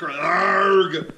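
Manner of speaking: very loud, high, long "arrrg"; pirate yell. Shown as a demonstration. Instructor showing how to regain core control, loud pirate yell. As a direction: roar it like a pirate. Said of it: "The other one is a pirate".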